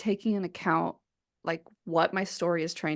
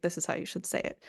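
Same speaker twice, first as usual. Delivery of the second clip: mock whisper; 7 dB quieter, faster than usual. Imitating written prescriptive note.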